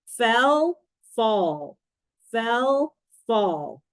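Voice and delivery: "Fell" on a rise, "fall" on a fall; teacher's careful listen-and-compare reading of "Fell" against "fall"; minimal pair demo. Shown as a demonstration. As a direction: contrast the vowels of "Fell" and "fall", word by word. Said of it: "pronounced almost the same except for one vowel sound".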